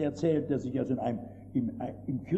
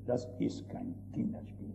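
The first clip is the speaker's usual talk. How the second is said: "Das ist kein Kinderspiel" breathed as a whisper; soft whispered echo of "kein Kinderspiel"; whispered repeat; 5 semitones lower, 10 dB quieter than usual. Acted. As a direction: drop to a hushed whisper to press it home. Repeats the phrase, now whispered.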